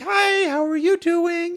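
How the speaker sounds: high, slow, sweet greeting; a high, gentle hello to a date; sweet shy girl. Retold or imitated. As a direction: chirp it like a shy nerdy girl. Voicing the sweet nerdy girl.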